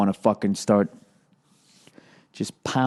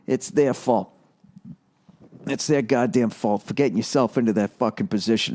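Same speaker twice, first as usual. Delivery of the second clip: ordinary delivery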